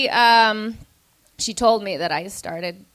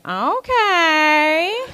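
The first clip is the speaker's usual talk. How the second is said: bemused okay; 7 semitones higher, 4 dB louder than usual. Retold or imitated.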